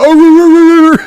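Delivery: loud, high howling Scottish bark; Scottish-accented dog bark. Retold or imitated. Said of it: "with a Scottish accent still".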